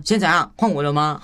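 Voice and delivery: mock pushy, heated question; confrontational demo. Shown as a demonstration. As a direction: demand your turn with a touch of aggression. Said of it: "我不会说".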